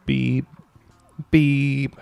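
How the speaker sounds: high, drawn-out spoken beep; imitated censor beep. Host beeping out girlfriend's last name, imitated censor beep. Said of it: "I'll beep your last name".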